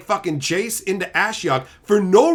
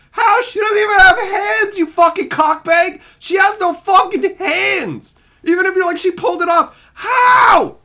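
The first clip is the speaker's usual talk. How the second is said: much higher, much louder, yelling in outrage; furious shout; 14 semitones higher, 10 dB louder than usual. Real, not acted. Ranting at Wizards about Nahiri's missing hands, furious shouting. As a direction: scream at the writers in disbelief.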